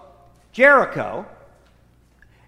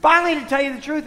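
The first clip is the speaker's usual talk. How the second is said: normal talking voice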